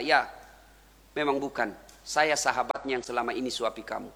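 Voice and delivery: hushed, low and sorrowful; tearful confession, retold. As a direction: confess softly, holding back grief. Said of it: "menetes air matanya lalu berkata".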